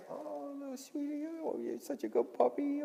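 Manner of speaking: put-on high, soft baby talk to a puppy; cooing to a puppy. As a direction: coo at the puppy like a doting owner. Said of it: "go up to your dog and say".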